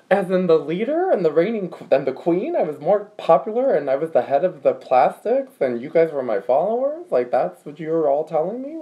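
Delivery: retold indignant amazement, rising into questions; mock disbelief. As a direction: play up being astonished by the news. Retold disbelief at being called Regina.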